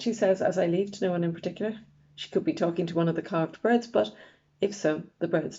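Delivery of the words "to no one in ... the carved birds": plain reading voice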